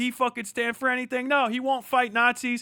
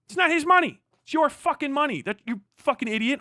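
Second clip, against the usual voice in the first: angry outburst; 7 semitones higher, 3 dB louder than usual. Real, not acted. Ranting at donors' fans.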